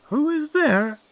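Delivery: very high, thin granny voice; old grandmother. Acted. Grandmother answers the door.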